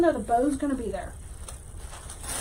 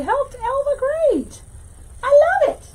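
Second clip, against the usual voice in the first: excited praise; 11 semitones higher, 4 dB louder, slower than usual. Real, not acted. Praising viewer's first wreath.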